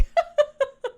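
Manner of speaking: high-pitched laughing fit; high laugh burst. Real, not acted. Streamer laughing hard at a meme.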